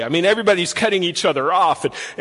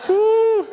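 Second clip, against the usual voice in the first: real laugh. Real, not acted. Pastor tickled by his Sabbath joke, laughing high. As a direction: crack up at your own joke and whoop.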